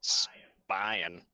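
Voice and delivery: unvoiced whispery grumble; grumbled. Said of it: "the other one grumbles".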